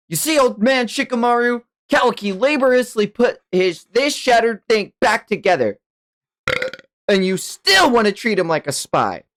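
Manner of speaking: high-pitched, argumentative youngster; Boruto, indignant. Acted. Hothead defends his friend to old Shikamaru, high and indignant.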